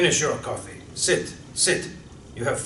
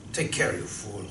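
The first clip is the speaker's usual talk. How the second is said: muffled voice; 9 semitones lower, 9 dB quieter than usual. Acted. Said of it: "officer's muffled voice".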